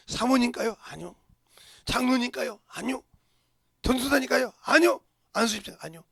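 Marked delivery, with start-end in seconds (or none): none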